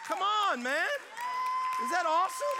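loud, high shouted praise; excited shout. Real, not acted. Preacher shouting praise to the congregation. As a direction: shout it to the crowd. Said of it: "preacher shouting excitedly".

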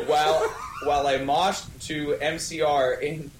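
ordinary delivery

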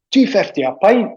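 put-on Fife accent, quoting the manager again; light Fife accent on the pint line. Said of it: "his Fife accent".

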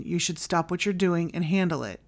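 usual speaking voice

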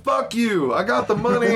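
high, hammed-up defiance; mock defiant Kenny. Kenny defying the blackmailers, high mock anger.

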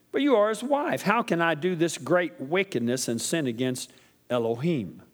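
lively, high scripture reading. Acted. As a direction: read the verse with fervour.

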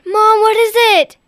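loud, high, slow worried call; alarmed. Acted. Child alarmed by scream.

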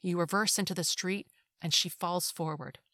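plain reading voice

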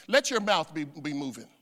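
ordinary delivery

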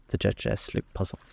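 usual speaking voice

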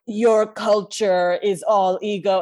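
solemn mystic mentor. Retold or imitated. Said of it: "Tilda Swinton being like".

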